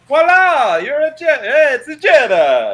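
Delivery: high, loud showy voila; sing-song mock announcement. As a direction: announce it grandly, mocking. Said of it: "not like".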